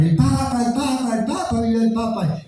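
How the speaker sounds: high, excited chant of the crowd; high crowd chant calling out; crowd cheering. Retold or imitated. Crowd shouting for the Pope.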